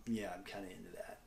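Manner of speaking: sheepish whispered confession; whispered aside. Real, not acted. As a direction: drop to a quick whisper, a bit embarrassed. Sheepish aside about meditation.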